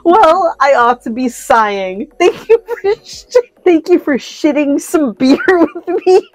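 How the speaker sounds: high, breaking up mid-speech; quote through laughter. Acted. Megalodon thanking the two friends, read through real laughter. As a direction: force the farewell out between laughs. Megalodon's farewell, reader cracking up.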